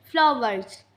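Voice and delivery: ordinary talk, nothing marked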